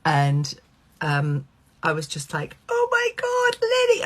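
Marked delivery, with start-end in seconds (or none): 2.7-4.0 very high, starstruck mock squeal; retold fan squeal; excited calling out. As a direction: call after a star, hammed up. Calling after a star in street.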